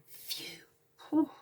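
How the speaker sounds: unvoiced breathy relief sigh; squirrel's relieved phew. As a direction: blow out relief like a small squirrel. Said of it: "A little squirrel saying".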